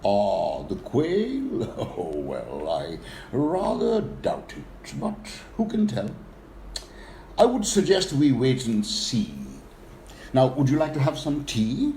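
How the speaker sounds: ponderous character voice with swooping high notes; elephant with gravitas. Acted. Elephant reassuring his worried pheasant friend, ponderous swooping gravitas. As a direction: draw the words out, doubtful and unhurried. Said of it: "gravitas and thus replied".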